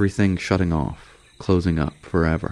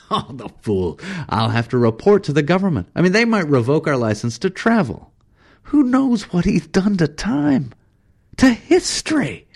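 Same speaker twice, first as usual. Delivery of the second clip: outrage climbing higher and higher; alarmed, angry guide working himself up; exasperated rant; 12 semitones higher, 3 dB louder than usual. Acted. Travis's rant continues, rising.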